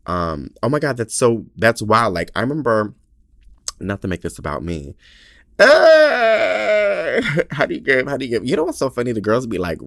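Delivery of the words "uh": loud, high mock sob; exaggerated crying howl; hammed-up wail. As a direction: let out a long, high cartoon wail. Bursts into wail while moved.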